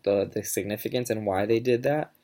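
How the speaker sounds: ordinary delivery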